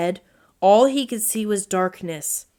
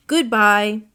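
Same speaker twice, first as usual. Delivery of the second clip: louder goodbye called after him; 5 dB louder than usual. A narrator's reading. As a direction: call it after the slammed door. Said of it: "called Owl".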